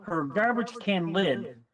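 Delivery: usual speaking voice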